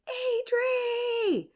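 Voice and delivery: very high, drawn-out call; imitated mom's yell. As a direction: sing out the name as if calling across the house. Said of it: "where she yelled my sister's name".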